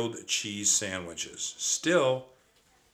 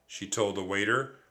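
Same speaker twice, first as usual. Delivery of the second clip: ordinary delivery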